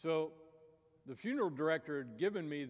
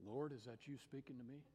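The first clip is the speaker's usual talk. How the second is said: hushed wondering; 10 dB quieter than usual. Acted. Fisherman asks the unseen voice.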